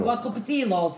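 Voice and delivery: high-pitched Yorkshire offer; Yorkshire accent. Shown as a demonstration. Offering husband tea as at home, Yorkshire accent. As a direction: call it out in Yorkshire dialect. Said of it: "in your Yorkshire accent".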